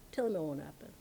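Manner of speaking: low, soft, matter-of-fact repeat; quiet assurance. Retold or imitated. Guru reassures the disciple about his death, quiet and sure. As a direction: drop right down and say it softly.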